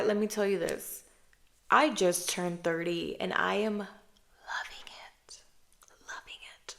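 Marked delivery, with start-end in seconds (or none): 3.8-6.7 whispered confession. Real, not acted. Confiding her age, whispered.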